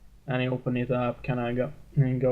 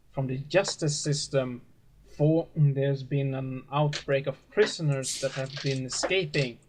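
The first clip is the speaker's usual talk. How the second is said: raised, lofty sermon voice of a gentle preacher; 3 semitones higher than usual. Acted. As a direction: preach to the flock like a kindly father. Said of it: "and he says".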